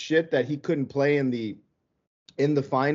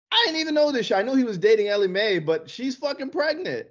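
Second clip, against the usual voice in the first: surprised; 8 semitones higher than usual. Real, not acted. Gossip, shocked by news.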